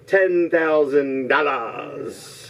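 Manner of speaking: game-show host milking the prize; drawn-out prize announcement. Real, not acted. Host tells contestants of the bonus round, drawn-out announcing. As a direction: stretch the prize out slowly.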